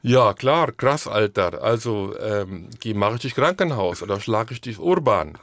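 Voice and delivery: loud imitating Kiezdeutsch street slang; Kiezdeutsch accent. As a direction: play a Neukölln youth in Kiezdeutsch. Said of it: "es gibt da dieses Kiezdeutsch".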